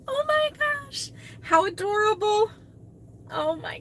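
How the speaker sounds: high, bright rising delight; delighted squeal. Real, not acted. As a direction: gush over something adorable. Reacting to baby sandals.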